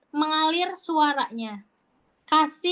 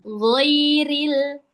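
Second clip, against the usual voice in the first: loud, drawn-out ghairil; sustained model ghairil; Quranic recitation; 5 dB louder than usual. Shown as a demonstration. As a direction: recite ghairil slowly and firmly. Teacher demonstrates recitation of word.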